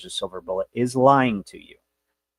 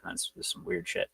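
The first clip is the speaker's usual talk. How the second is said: under the breath, voice dropped away; a whispered grumble about the glitch; whispered aside; 3 semitones lower, 14 dB quieter than usual. Real, not acted. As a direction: mutter it to yourself, almost soundless. Grumbling aside about computer sabotage.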